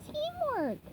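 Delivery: patient teacher sponge; gentle correction. Acted. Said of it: "gently corrected him".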